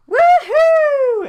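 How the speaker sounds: high, piercing, full-volume whoop; screamed woohoo. Acted. Joey cheering as loud as he can, screaming. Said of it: "screams it as loud as he can".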